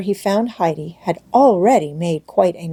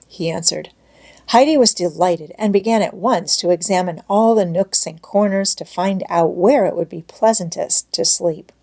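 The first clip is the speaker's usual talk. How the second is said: usual speaking voice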